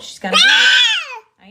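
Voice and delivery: high, drawn-out panicked cry; panicked shout. Real, not acted. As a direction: shout it out in alarm. Cat struggling in labour.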